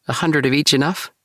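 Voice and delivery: a generous offer, lightly voiced; Nikki voice. A narrator's reading. Nikki offers seeds to J K, lightly voiced.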